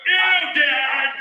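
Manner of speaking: put-on loud high teen squeal of disgust; disgusted daughter. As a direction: recoil at dad in shorts. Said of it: "my daughter goes".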